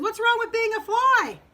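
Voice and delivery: high, loud storybook protest; indignant frog. Acted. Frog challenges Wolf about flies, indignant. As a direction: exclaim it, voice way up.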